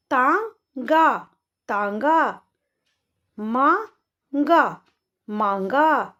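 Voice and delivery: each word drawn out slowly, then said again normally; slow then normal reading. Shown as a demonstration. Teacher reading word pairs slowly, then normally. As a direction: stretch the word, then repeat it plainly. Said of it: "तो कैसे पढ़ेंगे?".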